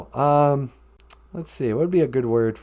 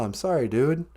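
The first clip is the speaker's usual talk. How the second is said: usual speaking voice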